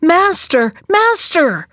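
high, urgent cry of alarm; calling out. A narrator's reading. The harp calling its sleeping master, high alarmed cry. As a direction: call out high to wake the master. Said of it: "the harp called out".

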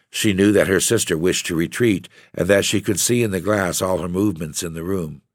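ordinary delivery, nothing marked

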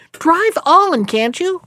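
mild impatient nagging. Acted. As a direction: push him to drive on. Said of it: "asked his wife impatiently".

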